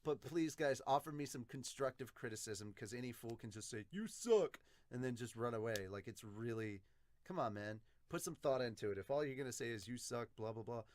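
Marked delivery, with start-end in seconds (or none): 3.9-4.6 loud, higher mocking heckle; mock jeer. Any fool commenter insulting the dub, mock jeer.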